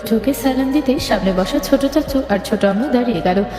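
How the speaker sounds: ordinary delivery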